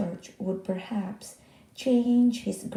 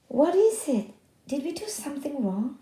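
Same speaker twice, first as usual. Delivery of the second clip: higher, louder anxious asking; worried question; 6 semitones higher, 3 dB louder than usual. Acted. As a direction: plead to understand what went wrong.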